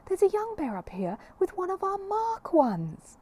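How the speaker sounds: high excited call, drawn out slowly. Acted. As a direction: shout the news down the stairs, thrilled. Calling his mate downstairs.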